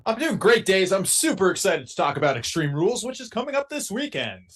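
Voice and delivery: host it like a radio DJ. Acted. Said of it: "loving the radio, uh, voice".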